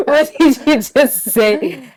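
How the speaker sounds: slow, high, loud mock disbelief; hammed-up puzzled question; imitating baffled mom. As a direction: draw it out like an incredulous mother. Mom's puzzled reaction acted out again.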